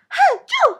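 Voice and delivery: high, explosive acted "achoo"; loud sneeze. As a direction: sneeze hard in a little princess voice. Princess sneezes in the story.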